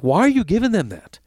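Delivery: exasperated. Retold or imitated. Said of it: "I'm like".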